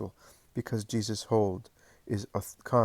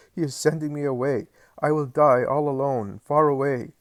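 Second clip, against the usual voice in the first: higher and louder lament; despairing shout; 5 semitones higher, 6 dB louder than usual. Acted. John telling Mary he is sent away, shouting. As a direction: throw the grief out loud.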